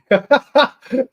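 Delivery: bursts out laughing, high and loud; a loud, high burst of real laughter. Real, not acted. Reacts to funny chat comment.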